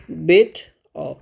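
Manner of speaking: "बेट अ" very slowly, broken before अ; syllable break. Shown as a demonstration. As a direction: teach the split: "बेट", pause, then "अ" on its own. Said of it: "इस तरह से syllable में टूटेगा". ("बेट अ" धीरे-धीरे, अक्षर अलग करके)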